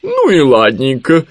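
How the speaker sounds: high, loud, drawn-out concession; suddenly easygoing Owl. A narrator's reading. Owl suddenly changing her mood, drawn out and easygoing. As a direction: switch to blithely letting it go. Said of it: "внезапно сменила настроение сова".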